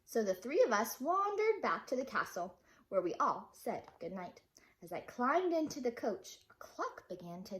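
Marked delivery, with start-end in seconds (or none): none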